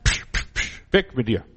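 laut und hoch dem Berg befohlen, mit Zischlauten; befehlender Glaubender. Pretend. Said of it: "wir sind Königskinder, wir befehlen". (Befehl an den Berg, psch)

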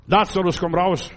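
a ringing summons to a dead man; commanding call. Acted. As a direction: call him out of the tomb. Jesus calls Lazarus from grave.

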